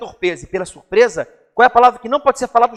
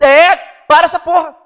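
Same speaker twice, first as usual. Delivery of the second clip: retold shout; 7 semitones higher, 10 dB louder than usual. Retold courtroom objection shout.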